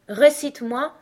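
loud, high-pitched order; commanding. A narrator's reading. Caterpillar telling Alice to recite, commandingly. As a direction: order her to perform. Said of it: "ordonna la chenille".

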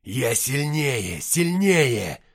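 loud, drawn-out battle roar, rising on the repeat; long-vowelled, full-force yell; insistent shout. A narrator's reading. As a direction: roar it slowly, pressing the attack. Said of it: "продолжал наступать и повторять".

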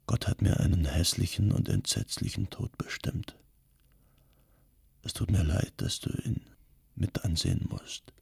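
soft, toneless whisper from a dying man. A narrator's reading. Dying imam to his wife at his bedside, hushed whisper. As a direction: whisper it with the last of his strength.

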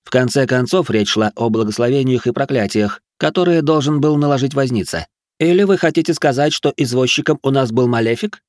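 testy, pitch climbing high; snappish arguing. A narrator's reading. Young count disputes the lost bet, snappish and rising. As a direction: argue back stubbornly, rising into an indignant question. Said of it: "огрызнулся помрачневший графёныш".

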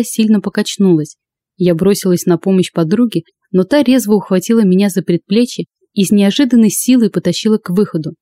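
plain reading voice

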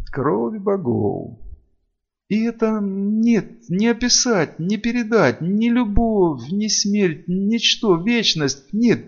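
high, slow, rapturous female character; awed, halting high-pitched confession; overwhelmed vampire servant. A narrator's reading. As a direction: confess in a high, swept-away voice.

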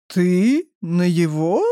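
slow, stunned disbelief; drawn out in utter amazement; astonished. A narrator's reading. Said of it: "изумился Мангуст". (изумлённо, медленно)

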